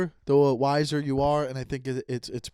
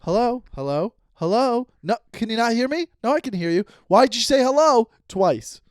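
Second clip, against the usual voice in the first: high, mock-irritated back-and-forth; exasperated phone exchange; 7 semitones higher, 6 dB louder than usual. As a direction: replay a maddening bad-connection call, pitched up. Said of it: "your double hellos".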